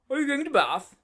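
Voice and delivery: 1 brusque challenge. Acted. Stranger challenges Pickwick.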